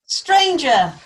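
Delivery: loud, high commanding call, falling away. A narrator's reading. King calls to a stranger in his hall, loud call. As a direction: call out across a great hall. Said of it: "called Higelac".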